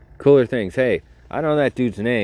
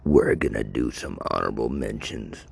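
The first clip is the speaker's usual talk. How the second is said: mock-solemn announcer; 10 semitones lower, 6 dB quieter than usual. Podcaster introduces honorable mentions, mock-solemn announcer.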